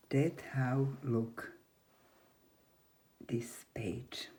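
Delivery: usual speaking voice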